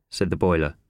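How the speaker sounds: plain reading voice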